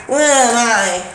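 ordinary talk

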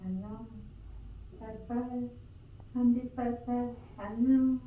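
sing-song goodbye. Real, not acted. Waving goodbye like to a child.

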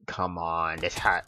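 ordinary delivery

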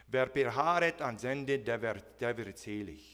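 scripture quoted in German; German quote. Shown as a demonstration. Pastor quoting the German verse to the church, plainly. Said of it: "first quote it in German".

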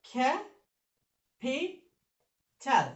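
"খ্যা ফি চাল" as three isolated beats; teacher chopping the word into "খ্যা", "ফি", "চাল"; broken into syllables. Shown as a demonstration. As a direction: say "খ্যা", "ফি", "চাল" one by one with long pauses. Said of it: "কয় syllable".

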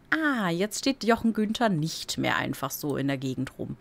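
usual speaking voice

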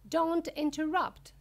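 high, loud reprimand; sharp rebuke. A narrator's reading. Wonka to interrupting Mike, a high, loud rebuke. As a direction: cut the child off loudly.

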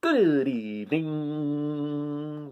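very slow, whirring drawn-out greeting; long humming whirr; whirring dragonfly. Acted. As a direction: whirr it out like wings gliding. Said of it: "whirred a dragonfly".

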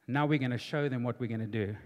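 low, measured divine resolve; lightly voiced God. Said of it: "And God said to him".

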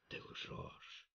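breathed, almost unvoiced; hushed outburst. A narrator's reading. King to the lying sentry, stunned whisper. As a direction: hiss the accusation under the breath. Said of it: "гневно воскликнул Цервус".

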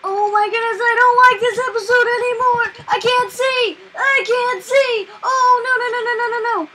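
loud, high frantic yelling; real fear, rushing then pleading; panicked shouting. Real, not acted. Panicking in the dark cave.

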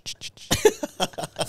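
slow, breathy shh to hush someone; long unvoiced shushes; shushing. Real, not acted. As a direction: hush her mid-argument. Shushing the other speaker.

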